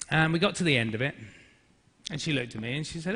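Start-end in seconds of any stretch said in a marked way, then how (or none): none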